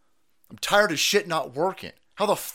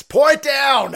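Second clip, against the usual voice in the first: hammy toast shout; 10 semitones higher, 13 dB louder, slower than usual. Pretend. Toasting his drink to chat.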